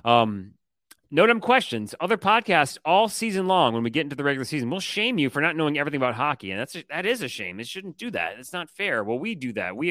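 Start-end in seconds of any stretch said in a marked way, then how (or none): 1.1-3.7 segment announcer. Acted.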